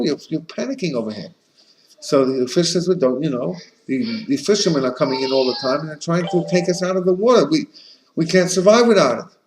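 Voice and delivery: a hint of a worried fish explaining its danger; fish answering fox.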